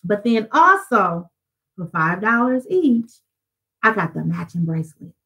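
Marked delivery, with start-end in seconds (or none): none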